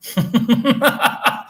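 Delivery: high, breathy run of laughter; amused laugh. Real, not acted. Streamer cracking up at the chat, amused laugh. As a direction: laugh in a quick, high chuckle.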